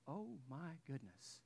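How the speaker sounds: a quiet, overwhelmed gasp of words; stunned under breath. Retold or imitated. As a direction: let it out slowly, overwhelmed. Stunned by her plea.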